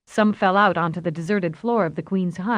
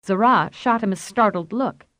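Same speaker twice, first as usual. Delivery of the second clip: ordinary delivery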